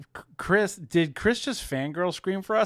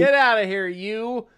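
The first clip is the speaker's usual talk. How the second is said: playful shout; 6 semitones higher, 8 dB louder than usual. Acted. Mock shooing someone.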